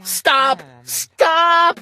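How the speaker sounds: high, rising cries of stop; little sister's frantic scream. A narrator's reading. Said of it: "my little sister screamed".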